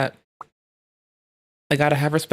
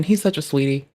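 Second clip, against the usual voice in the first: ordinary delivery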